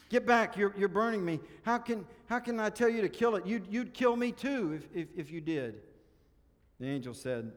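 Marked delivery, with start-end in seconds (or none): 0.1-5.8 loud, high frantic protest; acted panic, easing at the end; panicked ghost. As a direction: stammer in alarm as if being burned. Ghost burned, refuses the kill.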